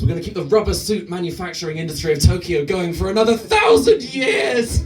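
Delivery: rising to a shout on years; building shout. Retold or imitated. Honda's excited pitch, shouting.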